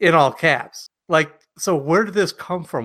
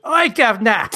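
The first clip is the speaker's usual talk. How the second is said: loud, high mock-Cockney cry; Cockney accent; 9 semitones higher, 6 dB louder than usual. Podcast host mocking Cockney as a Romance language, exaggerated Cockney accent. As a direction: call out in a hammed-up Cockney accent. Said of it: "Cockney British?".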